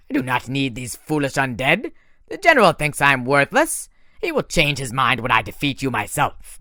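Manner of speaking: a bitter monster boasting of revenge; French-accented villain. A narrator's reading. Manticore's voice again.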